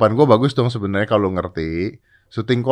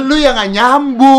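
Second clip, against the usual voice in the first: indignant retort; 13 semitones higher, 5 dB louder, slower than usual. Real, not acted. Couple bickering.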